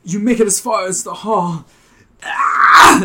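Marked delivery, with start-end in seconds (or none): 2.2-3.0 loud high battle scream; war cry scream. Acted. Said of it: "Spidey lets out a war cry".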